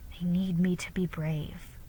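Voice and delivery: soft whispered inner thought; a whispered private resolve; thinking to herself. A narrator's reading. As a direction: whisper it to yourself. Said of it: "she thought".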